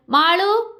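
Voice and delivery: calling her name from another room, pitch rising high. A narrator's reading. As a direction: shout her name across the house. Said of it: "വിനോദിന്റെ വിളി കേട്ടു". (ഹാളിൽ നിന്ന് ഉച്ചത്തിൽ വിളിക്കുന്നു)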